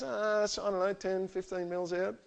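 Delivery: high, sheepish student voice. Retold or imitated. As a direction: sheepishly own up to the mistake. Student sheepishly admits big error.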